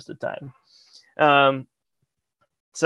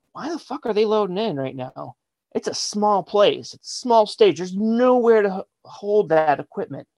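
baffled exasperation; 6 semitones higher than usual. Retold or imitated. Retold bafflement at loading in.

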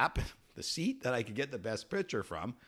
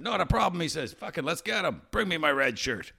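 higher, swaggering order; cocky captain; 7 semitones higher than usual. Retold or imitated. Pirate captain rallying his crew, higher cocky order. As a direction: brush it off and rally the men.

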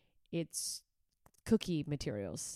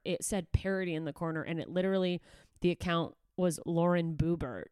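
normal talking voice

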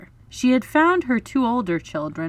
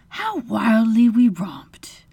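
slow, breathy reminiscence; wistful recalling; 3 semitones higher, 4 dB louder, slower than usual. A narrator's reading. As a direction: sigh over a fond memory. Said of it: "recalling it".

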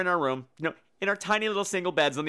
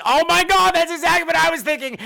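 high, loud oh my God; big friend realizing they share the same fear; excited; 11 semitones higher, 7 dB louder than usual. Retold or imitated. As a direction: blurt it out in shared horror.

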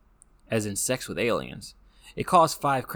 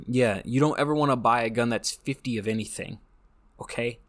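usual speaking voice

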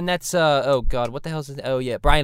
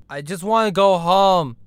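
usual speaking voice

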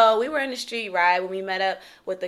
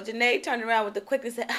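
ordinary delivery

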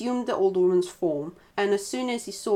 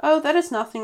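higher, louder breezy dismissal; rabbit replying; 8 semitones higher, 5 dB louder than usual. A narrator's reading. Rabbit hides his trick from the badger, breezy reply. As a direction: brush it off innocently. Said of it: "replied the rabbit".

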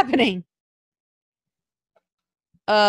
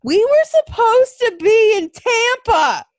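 angry outburst; 9 semitones higher, 6 dB louder than usual. Real, not acted. Venting over the cancelled trip.

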